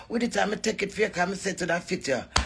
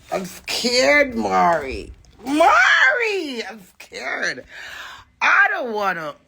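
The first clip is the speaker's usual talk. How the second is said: hammed-up frightened wail, much higher and louder on the shout; mock scared cry; 5 semitones higher, 3 dB louder, slower than usual. To Amari, mocking her fear: hammed-up scared wail. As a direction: pretend panic calling out for Mari.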